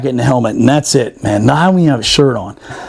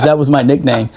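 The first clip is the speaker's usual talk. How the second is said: ordinary talk, nothing marked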